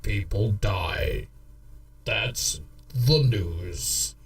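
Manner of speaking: low, slow mock newsreader; news anchor voice. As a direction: read it like a deadpan anchor. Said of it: "I'm gonna bring you the news".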